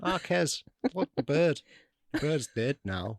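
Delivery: put-on broad Northern English accent; playful Northern English voice. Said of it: "subjecting us to your Northern accent".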